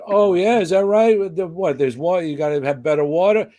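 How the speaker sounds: egging him on with mock interest; lightly voiced brash host; Johnny's voice. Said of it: "I said".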